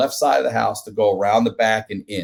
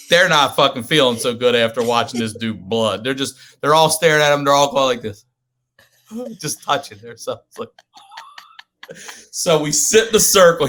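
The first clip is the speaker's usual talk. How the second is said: ordinary talk, nothing marked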